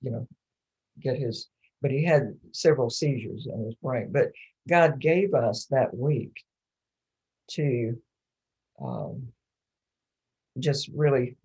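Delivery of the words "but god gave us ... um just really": slow with long searching pauses; slow, halting. Real, not acted. Guest recalling her son's last week, slow and halting. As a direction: feel for each word, leaving long gaps. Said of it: "I'm not speaking fast enough".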